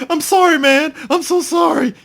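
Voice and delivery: high squeaky fake apology; mock apology. Player apologizing after sweeping the leg, high mock whine. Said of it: "he's like".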